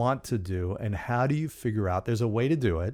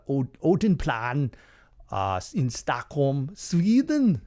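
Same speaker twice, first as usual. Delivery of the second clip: put-on slow, sing-song Swedish accent; 4 semitones higher, slower than usual. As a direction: say it in a Swedish accent, rising at the end. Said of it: "I'll work on my Swedish accent".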